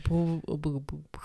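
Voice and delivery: teasing nonsense stammer; mocking stutter. Co-host teasing the other's stumbled words, mock stuttering.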